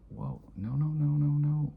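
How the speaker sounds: rising into loud, high pleading no's; panicked denial. Retold or imitated. Scared kid denying to his angry father, rising loud no's. Child fearfully denying, retold.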